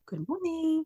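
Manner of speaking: soft, high-pitched hello back; imitated greeting reply. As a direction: return a passer-by's greeting, high and light.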